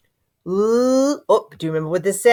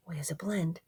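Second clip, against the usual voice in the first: soft unvoiced whisper; whisper aside; 4 semitones lower, 17 dB quieter, faster than usual. Real, not acted. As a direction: whisper it to yourself. Teacher whispers aside while decoding.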